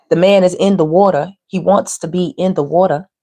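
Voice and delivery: ordinary talk, nothing marked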